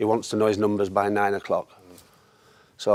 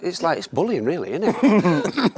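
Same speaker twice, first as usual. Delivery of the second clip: ordinary talk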